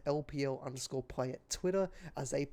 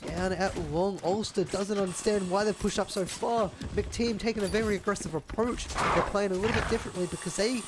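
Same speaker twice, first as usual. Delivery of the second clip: high, loud, rapid-fire esports casting; hyped shoutcaster riding the clutch round; live play-by-play; 7 semitones higher, 7 dB louder than usual. Acted. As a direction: call every kill like the crowd is on its feet.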